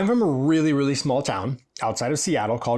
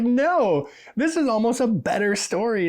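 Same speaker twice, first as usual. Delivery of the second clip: delighted client; 10 semitones higher, 3 dB louder than usual. Retold or imitated.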